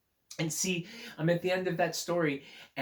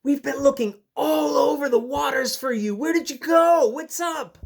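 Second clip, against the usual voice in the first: high, loud, eager friend calling out; a bubbly sea creature who missed her pal; excited Narwhal; 12 semitones higher, 13 dB louder than usual. Acted. Reading Narwhal's lines.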